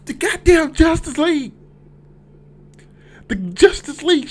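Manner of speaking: high, loud hype; excited shouting. Real, not acted. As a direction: yell it out in disbelief, way up high. Hyped reaction to surprise cameo.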